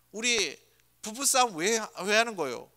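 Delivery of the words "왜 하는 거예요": ordinary talk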